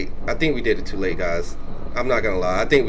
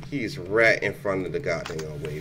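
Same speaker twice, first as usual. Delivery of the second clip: ordinary talk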